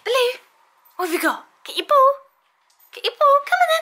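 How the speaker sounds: excited dog play talk. Real, not acted. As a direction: hype the dog up for his ball.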